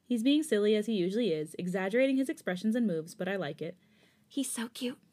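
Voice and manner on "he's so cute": breathy whispered inner thought; whispered aside. A narrator's reading. Listener admiring the singer as he performs, whispered aside. Narrator's private thought in story.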